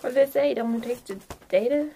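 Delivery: usual speaking voice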